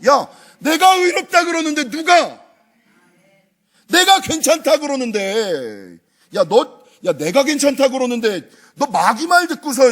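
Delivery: God scolding a doubting believer; God's rebuke. Retold or imitated. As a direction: scold like a father who forgives.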